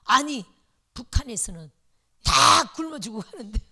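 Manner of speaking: loud, indignant rebuke of the church; elder deaconess loudly scolding the church for eating well; angry scolding. Retold or imitated. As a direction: scold the young pastor's wife loudly, outraged. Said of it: "막 역정을 내는 거예요".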